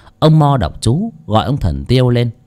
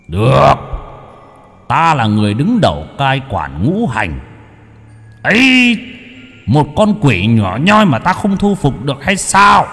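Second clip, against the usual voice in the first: proud booming deity; 3 semitones higher than usual. Acted. Deity boasting he can tame the demon.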